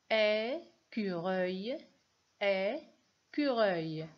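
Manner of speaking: "Écureuil" slowly split into é, pause, cureuil; syllable by syllable. Shown as a demonstration. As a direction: hold the é of "Écureuil", then finish the word. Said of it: "il y a combien de syllabes". (« Écureuil » découpé lentement : é… cureuil)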